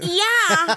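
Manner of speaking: loud, high blurted yell; improvised face sound. Pretend. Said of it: "you provide the sound".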